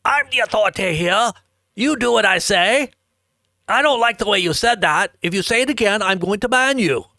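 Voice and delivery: hammed-up self-important commanding voice; authority complex. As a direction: lay down the law like a puffed-up moderator. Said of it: "this authority complex".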